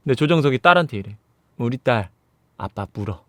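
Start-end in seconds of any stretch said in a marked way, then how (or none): 1.6-3.2 low, hushed father's voice; subdued acted plea; quiet plea. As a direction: softly urge your daughter, pitched low. Father quietly begs daughter to bite.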